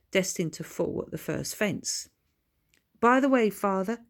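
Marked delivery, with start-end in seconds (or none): 3.0-4.0 smug. Acted. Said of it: "continued Billy smugly".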